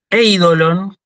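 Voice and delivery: "Eidolon" with the opening E stressed; first-vowel stress. Shown as a demonstration. Teacher gives an example of the diphthong rule, first-vowel stress. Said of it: "se pronuncia en la primera".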